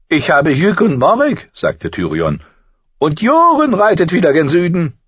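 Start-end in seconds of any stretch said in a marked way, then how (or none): 0.1-1.5 deutlich höher und lauter, spöttischer Zwerg; Tyrion, hohe Stimme. A narrator's reading. Tyrion spricht. 3.0-5.0 deutlich höher und lauter, spöttischer Zwerg; Tyrion, hohe Stimme. A narrator's reading. Tyrion spricht.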